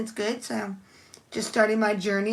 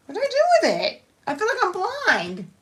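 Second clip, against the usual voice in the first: baffled disbelief; 8 semitones higher than usual. Real, not acted. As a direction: squeak out where did it go. Still can't find the bow.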